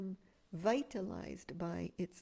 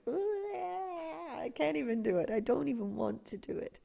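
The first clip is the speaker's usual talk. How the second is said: mock groan; 7 semitones higher, 3 dB louder than usual. Acting out dread of disconnecting.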